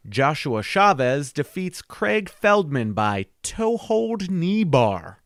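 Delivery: slow, rising announcer read. Acted. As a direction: stretch the finish like an announcer. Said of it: "I sound like a Bates Ball announcer".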